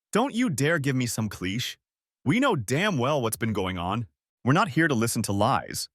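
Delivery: plain reading voice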